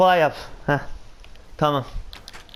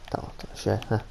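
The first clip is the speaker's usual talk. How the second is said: whispered; 7 semitones lower, 9 dB quieter, faster than usual. Real, not acted. Careful placing, muttered to himself.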